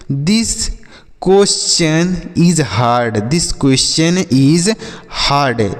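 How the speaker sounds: each word drawn out slowly and clearly; teacher reads out This question is hard very slowly; slow model sentence. Shown as a demonstration. Teacher models an English sentence for students, slowly. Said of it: "या ऐसे बोल सकते हैं".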